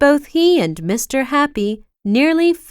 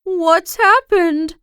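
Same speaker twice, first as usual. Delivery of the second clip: higher, slow worried question; Mister Happy noticing her sad face, anxious and slow; 7 semitones higher, 3 dB louder, slower than usual. A narrator's reading. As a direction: ask with real concern in the voice. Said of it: "he asked anxiously".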